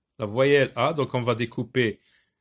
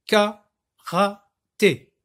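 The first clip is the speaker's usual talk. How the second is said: Karaté very slowly as KA, RA, TÉ; loud, higher beats with long pauses in Karaté; three sound units; 6 semitones higher, 6 dB louder, much slower than usual. Shown as a demonstration. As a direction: break Karaté into three separate syllables. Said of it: "j'ai prononcé le mot en trois unités de son".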